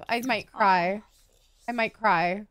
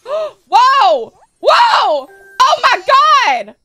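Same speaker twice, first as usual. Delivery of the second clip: loud, high shocked outburst; gasping, drawn-out shock; 4 semitones higher, 11 dB louder, much slower than usual. Real, not acted. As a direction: shout it in startled disbelief. Startled by her aged-up baby.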